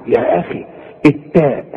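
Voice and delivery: normal talking voice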